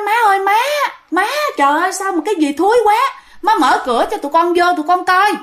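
high-pitched, urgent appeal to mother; acted begging through a shut door; pleading call. Children outside the stinking room to mother, urgent pleading call.

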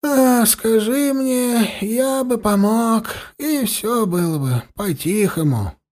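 put-on high, slow, trembling old voice with a sigh; quavering voice. A narrator's reading. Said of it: "дребезжащий голос произнёс со вздохом". (дребезжащий голос со вздохом)

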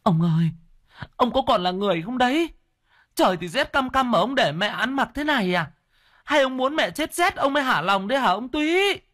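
very high, wailing reproach; anguished wife crying out in accusation; tearful scolding. Acted. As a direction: scold your husband through mock tears. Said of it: "vừa khóc vừa mắng".

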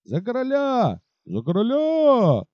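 high, drawn-out cheering chorus; a crowd of soldiers roaring; soldiers' chorus. Acted. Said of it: "разом подхватили полсотни голосов". (хор солдат)

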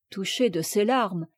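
ordinary delivery

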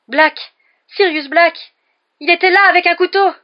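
high, loud panicked cries; terrified shouting. A narrator's reading. Ron warns his roommates of an intruder, terrified shout. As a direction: shout the alarm in terror. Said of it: "une expression d'intense terreur".

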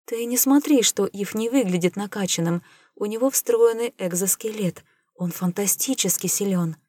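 ordinary delivery, nothing marked

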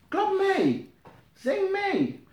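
very high calls to clap and sing; urging call. Real, not acted. Preacher urging the church to clap and sing along, pitched very high. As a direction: lift the room with your voice.

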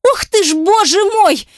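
very high mocking exclamation; hysterical cry. A narrator's reading. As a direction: shriek it in hysterical sarcasm. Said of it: "истерически вскрикнула она".

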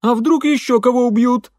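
high, loud character voice, fretting; alarmed servant. A narrator's reading. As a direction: cry out the worry across the house. Служанка тревожно кричит из дома.

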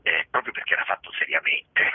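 ordinary delivery

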